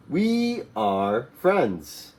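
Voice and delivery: slow model reading. Shown as a demonstration. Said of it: "With the S".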